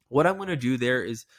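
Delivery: ordinary delivery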